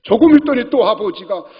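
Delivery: normal talking voice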